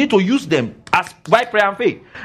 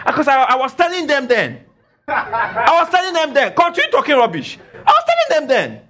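emphatic vindication; 8 semitones higher than usual. Real, not acted. Preacher reminding congregation he warned them, high emphatic vindication. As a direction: insist on it in a high, stretched cry. Indignant repeated boast to congregation.

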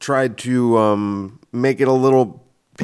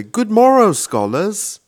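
much higher and louder, morrow falling, scholars rising; mock British professor; 12 semitones higher, 6 dB louder, slower than usual. Professor walking into the room, mock-British greeting. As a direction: sweep in with a grand mock-British hello. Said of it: "I would walk in the room and I would say".

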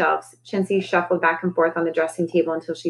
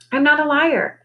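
high, squawky parrot voice; 8 semitones higher than usual. Acted. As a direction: voice the parrot, indignant. Parrot character insists she's honest.

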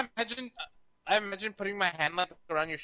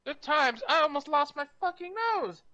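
much higher-pitched mock outrage; squeaky playful indignation; mock indignant; 10 semitones higher than usual. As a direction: echo it back in high-pitched protest. Mock-indignant in-character reply.

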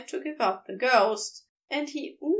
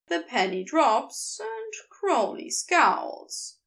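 plain reading voice

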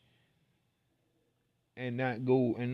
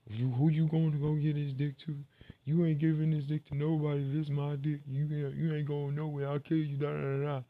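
put-on higher, louder crazy girlfriend rant; berserk rage; 4 semitones higher, 4 dB louder than usual. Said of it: "she's quote unquote crazy, and she's like".

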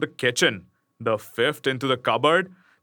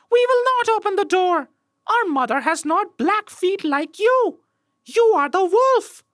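put-on kids crying out; 4 dB louder than usual. A narrator's reading. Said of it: "the children saw them and cried".

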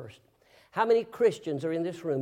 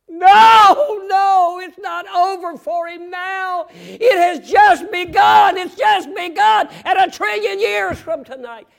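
shout; 14 semitones higher, 20 dB louder, slower than usual. Acted. Preacher shouts rebuttal about hell.

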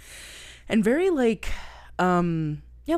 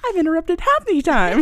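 imitating David Spade; 10 semitones higher, 7 dB louder than usual. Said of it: "and he's just like".

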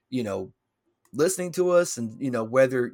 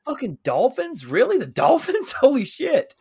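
much higher, louder shocked disbelief; 12 semitones higher, 6 dB louder than usual. Real, not acted. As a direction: blurt it out, stunned. Disbelief at Dolphins being undefeated.

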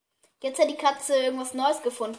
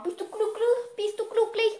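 cooing pet talk; 9 semitones higher than usual. Real, not acted. Owner asking her cat after playing, cooing.

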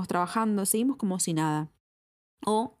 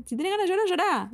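a light imitation of a diva's catchphrase, higher; Moria Casán; 12 semitones higher, faster than usual. Quoting a TV diva's favourite line, a light imitation, higher. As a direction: toss off the catchphrase like a showbiz diva, lightly. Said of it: "como dice Moria Kazan".

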